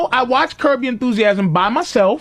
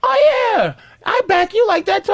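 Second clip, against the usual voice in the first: high mocking girlfriend voice, much higher; 10 semitones higher than usual. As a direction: squeak it like a doting girlfriend.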